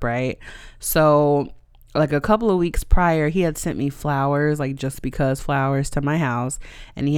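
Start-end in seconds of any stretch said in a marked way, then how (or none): none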